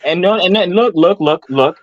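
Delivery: normal talking voice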